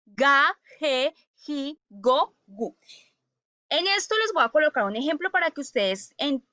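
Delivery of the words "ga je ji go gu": "ga, je, ji, go, gu" with j sound only before e and i; teacher recites "ga, je, ji, go, gu" spaced out; hard g in "ga, go, gu", throaty j in "je, ji"; correct G syllables. Shown as a demonstration. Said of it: "Es decir".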